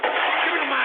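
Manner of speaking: distorted, frantic yell; raging outburst; screamed demand. Real, not acted. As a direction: holler for the mic. Screams for the microphone.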